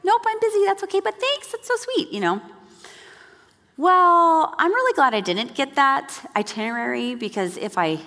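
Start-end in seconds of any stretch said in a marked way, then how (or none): 0.0-2.1 airy voice, pitched much higher, politely declining; cheerful much higher mock brush-off; breezy high brush-off. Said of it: "I was just like".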